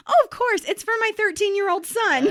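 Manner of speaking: high, sweet oblivious mom voice; high chirpy mother; cheery mom. Retold or imitated. Mom buying violent game for son.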